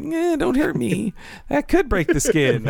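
high, timid nerd imitation; mock scared. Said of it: "I was scared of the Mo types".

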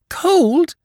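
high, loud shocked gasp. A narrator's reading. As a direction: gasp the word in horror. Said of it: "gasped the mother".

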